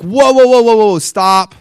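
high, loud whoa whoa stop; alarmed protest. Retold or imitated. Preacher stopping God's praise, loud and alarmed. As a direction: halt God mid-compliment.

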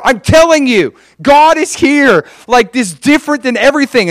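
high, loud, pleading conviction; buzzing insistence. Acted. Insisting to doubtful family members, buzzing insistence. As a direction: hammer it home to a doubter, fired up. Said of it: "they were so buzzing".

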